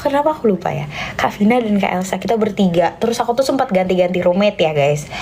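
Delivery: ordinary talk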